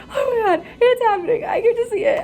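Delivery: high squealing delight with a laugh; giddy student about to see a birth; thrilled. Retold or imitated. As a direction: gush like you can't believe your luck. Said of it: "And I was so excited".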